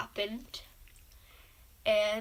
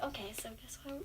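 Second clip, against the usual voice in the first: a teasing whisper; 2 semitones lower, 15 dB quieter than usual. Real, not acted. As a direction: whisper it to build suspense. Building suspense for the audience.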